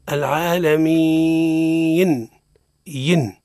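long "العالمين" breaking into an extra "-yin"; chanted "العالمين" with a squeezed extra syllable at the end; distorted madd. Shown as a demonstration. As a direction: wrongly drag "مين" off course into "mii-yin". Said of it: "حزقلة، هنا في المد يخرج عن مساره".

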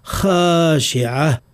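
خاشعة with a moderately dark khaa and long a; correct heavy kha. Shown as a demonstration. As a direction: say خاشعة slowly, kha full but not exaggerated. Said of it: "فننطق بالخاء".

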